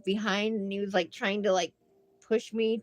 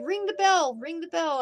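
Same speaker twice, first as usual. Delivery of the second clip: excited; 10 semitones higher, 5 dB louder than usual. Retold or imitated. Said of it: "he's like".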